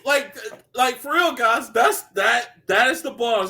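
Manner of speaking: ordinary delivery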